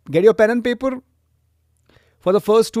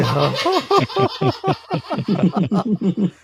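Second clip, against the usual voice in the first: pinched voice; 3 semitones higher than usual. Real, not acted. Group laughter session.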